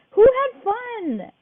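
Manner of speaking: high, loud, slow stretched question; excited cheer. Real, not acted. Game leader cheering kids.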